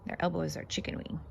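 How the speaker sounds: soft quick whisper. Real, not acted. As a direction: whisper it close and hushed. Whispered aside and reading.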